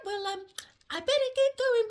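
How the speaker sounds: plain reading voice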